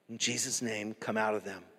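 low, soft, unforced deliverance; calm quiet command. Retold or imitated. Preacher showing a gentle word to demons, quietly.

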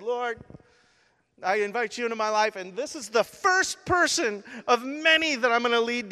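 high, loud, fervent plea; impassioned prayer. Retold or imitated. New convert vowing to God, high fervent retold prayer. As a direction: pray it out high and urgently, re-enacting.

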